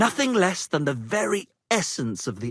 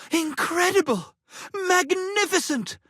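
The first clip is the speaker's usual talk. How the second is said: gushing delight; 10 semitones higher than usual. Acted. Minstrels gushing over praise.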